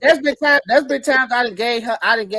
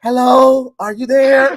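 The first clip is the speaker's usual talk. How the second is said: a hint of a phone call voice, louder; meme voice; 2 semitones higher, 6 dB louder, slower than usual. Joking to the hosts about a meme, mock phone greeting. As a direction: act out the meme's silly phone greeting. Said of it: "that meme".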